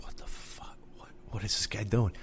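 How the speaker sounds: whispered aside, disbelieving; whispering his baffled reaction to the clerk; incredulous whisper. Retold or imitated. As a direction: hush it like a private thought. Inner reaction to odd clerk.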